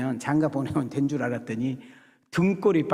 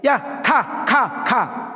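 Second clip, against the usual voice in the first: high, louder shooing scold; shooing away; 9 semitones higher, 6 dB louder, much slower than usual. Retold or imitated. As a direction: wave the kids off, fed up. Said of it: "이랬을 거라는 생각이 드는 거예요". (아이들을 쫓아내는 제자의 짜증 섞인 높은 외침)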